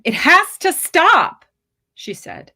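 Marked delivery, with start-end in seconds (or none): 0.0-1.4 high, loud, slow desperate plea. Acted. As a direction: beg him with rising panic. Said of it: "She felt more desperate".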